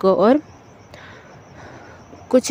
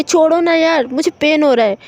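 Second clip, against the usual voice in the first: a half-raised, irritated protest; mild shout; 5 semitones higher than usual. Acted. Roshni snapping at Rashmi to let go, mild shout. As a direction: push her off with a mild yell. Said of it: "थोड़ा सा चिल्ला के कहती है".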